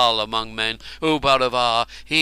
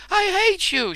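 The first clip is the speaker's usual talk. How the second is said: very high furious scream; 4 dB louder than usual. Acted. Chained woman screaming at the warrior, very high. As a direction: shriek it at him. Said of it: "she was screaming".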